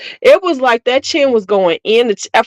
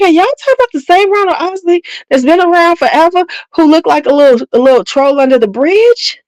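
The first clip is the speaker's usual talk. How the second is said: higher and quicker, openly disbelieving; incredulous mock voice; 8 semitones higher, faster than usual. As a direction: ask it like they've lost their minds. Mocking fans of [name].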